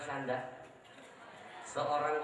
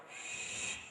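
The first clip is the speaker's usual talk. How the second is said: soft shush; 7 dB quieter than usual. Retold or imitated. Mother hushing from field edge.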